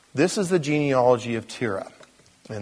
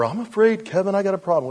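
raised-pitch mock fretting; worried; 8 semitones higher, 4 dB louder, faster than usual. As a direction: play an anxious churchgoer, pitched up. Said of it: "worried about the number".

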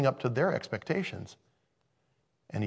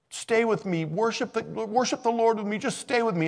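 high pleading voice, much higher; begging Saul; 11 semitones higher than usual. Retold or imitated. Preacher voicing Saul begging Samuel to stay, high and pleading. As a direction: plead like a desperate king. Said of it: "Saul was begging Samuel".

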